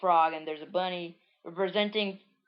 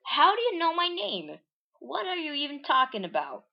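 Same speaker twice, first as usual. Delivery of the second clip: high, loud disbelief; high startled protest; shocked; 9 semitones higher, 4 dB louder than usual. Acted. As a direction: blurt it out in shock. Marina shocked at mind reading.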